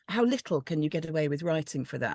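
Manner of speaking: ordinary talk, nothing marked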